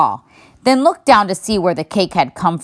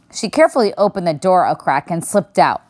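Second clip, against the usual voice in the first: plain reading voice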